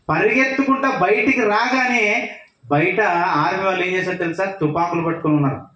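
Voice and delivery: ordinary talk, nothing marked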